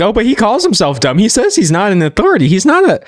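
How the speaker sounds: high-pitched whiny imitation of a fan; mock defensive fan. Audience defending their dumb idol, mocked in high pitch. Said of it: "His audience be like".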